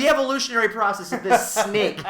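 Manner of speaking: high, loud disbelieving rant; incredulous outburst. Retold or imitated. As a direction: blurt your disbelief at him. Reacting to the scientist.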